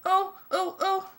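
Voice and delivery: high-pitched yelps of alarm; alarmed cry. A narrator's reading. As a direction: cry out high, in short bursts. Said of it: "someone else cried".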